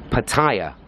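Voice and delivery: PATTAYA as puh-TIRE, short first syllable; pronunciation three. Shown as a demonstration. Vlogger showing a popular way, Pattaya as puh-TIRE. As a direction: say Pattaya like puh plus car tyre. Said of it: "pronunciation number three".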